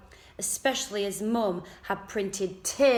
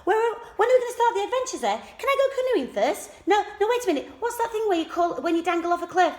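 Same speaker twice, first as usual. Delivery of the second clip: high, loud, rapid chatter; excited girl; 13 semitones higher, 8 dB louder, much faster than usual. A narrator's reading. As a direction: gabble it all out at once. Kelly's eager rush of questions.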